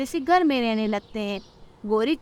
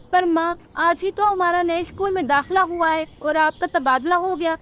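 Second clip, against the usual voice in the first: dismayed chick; 5 semitones higher than usual. Acted. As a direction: protest to mother in disbelief. Daughter dismayed at transfer.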